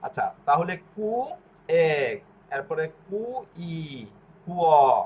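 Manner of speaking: কু then ই, then কুয় as one glide; slow syllable-by-syllable teaching; two-syllable demo. Shown as a demonstration. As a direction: build কু and ই slowly into কুয়. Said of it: "দুই অক্ষরের উচ্চারণ".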